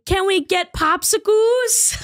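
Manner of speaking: put-on high, slow toddler voice; mock toddler whine; baby talk. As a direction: beg like a little kid. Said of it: "It's not in the baby accent".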